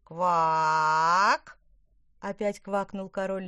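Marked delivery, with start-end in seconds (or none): 0.0-1.6 a huge, much louder croak; booming croak. A narrator's reading. The giant frog king croaks at them, booming loud. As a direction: let out a monstrous frog croak.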